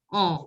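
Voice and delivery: On with the N only nasalized, no S; nasal vowel On, falling. Shown as a demonstration. Teacher explains the silent S, nasal On. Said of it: "N will be the nasal sound".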